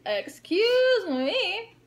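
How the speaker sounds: high, slow, drawn-out snooty "Excuse me"; mock snooty girl. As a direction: stretch it out like a stuck-up stranger. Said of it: "this girl was just like".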